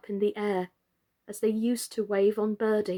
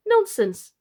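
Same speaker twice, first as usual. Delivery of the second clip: higher scoffing exclamation; dismissive; 8 semitones higher, 4 dB louder than usual. Acted. Emily dismissing Charlotte's worry, scoffing. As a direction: brush it off scornfully. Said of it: "asked Emily".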